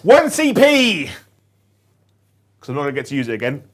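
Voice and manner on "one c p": loud, high, drawn-out announcement; triumphant call. Pretend. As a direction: sing it out like a big reveal. Triumphantly calls out command point.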